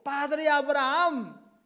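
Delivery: high, loud and slow cry. Acted. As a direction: stretch it out as a desperate howl. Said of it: "dando voces".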